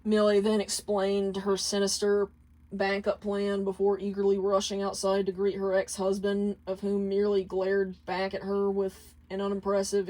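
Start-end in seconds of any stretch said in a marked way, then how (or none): none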